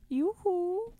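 high, sing-song yoo-hoo like the Frozen guy; Frozen shopkeeper. Retold or imitated. Co-host mocking the reading as the Frozen guy, high sing-song. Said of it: "like that guy in Frozen who's like".

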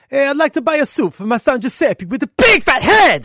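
put-on loud, high-pitched hammy Italian accent; Italian guy. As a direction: bellow it as an excitable Italian customer. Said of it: "the Italian guy goes".

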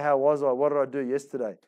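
ordinary delivery

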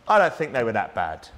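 lightly mocking the church critics; mock defensive protest. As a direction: mimic their excuse with a dip in pitch.

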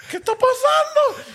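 high, slow, laughing disbelief; squeaky "what is going on" through laughter; stunned disbelief. Retold or imitated. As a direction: act out the shock, laughing at it. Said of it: "Y yo así".

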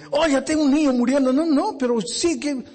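high, fast urgent appeal; frantic plea. Acted. Driver begging the doctor, high and frantic. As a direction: plead with the doctor for the dying boy.

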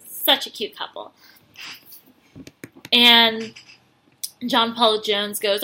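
ordinary delivery

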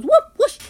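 high, loud whooshing dodge noises; mock whoosh sounds. King Kong dodging lasers, high loud whoosh sounds. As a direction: swoosh it out like a flipping ape. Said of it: "He goes".

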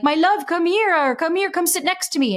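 sweet-talking father. Retold or imitated. Father switching from screaming to coaxing his four-year-old, high and syrupy. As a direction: lure the child close with sugary sweetness. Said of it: "two minutes later being like".

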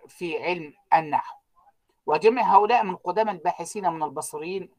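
ordinary talk, nothing marked

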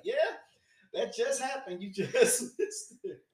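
high-pitched retelling with a laugh; imitated excited reaction. Telling what Natasha and them said, imitated excitement.

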